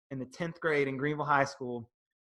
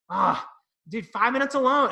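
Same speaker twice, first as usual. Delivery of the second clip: higher and louder, eager song pick; excited pick; 10 semitones higher, 5 dB louder than usual. Retold or imitated. Listing favourite Pantera songs for the episode, higher and louder excitement. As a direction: blurt out a favourite with delight.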